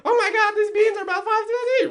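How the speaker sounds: very high-pitched, loud sidekick squeal; imitating high voice. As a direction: mimic a friend shooting up into an exaggerated high register. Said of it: "where you speak really high".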